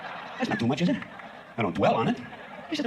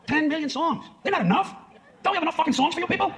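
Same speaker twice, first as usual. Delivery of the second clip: comic rant; 11 semitones higher than usual. Acted. Ranting about songs.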